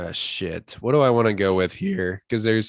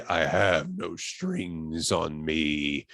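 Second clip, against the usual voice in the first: very slow, low, quiet menace; James Spader; 7 semitones lower, 8 dB quieter, much slower than usual. Retold or imitated. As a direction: draw it out softly and low, full of menace. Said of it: "the most menacing voice I've ever heard".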